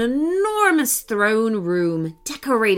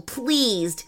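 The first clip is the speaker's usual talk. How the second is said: ordinary delivery, nothing marked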